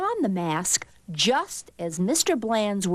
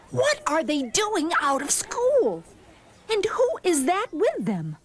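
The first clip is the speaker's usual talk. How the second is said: high-pitched scratchy questioning; 8 semitones higher than usual. A narrator's reading. Teacher puzzled by truant pupils, scratchy voice. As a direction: ask it in a raised, scratchy voice. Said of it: "she said in a scratchy voice".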